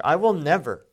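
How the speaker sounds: loud, forceful "never"; stress on never. Retold or imitated. Peter vows he won't deny Jesus, emphatic "never". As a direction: swear it, hitting "never" hard. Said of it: "That's what Peter says here".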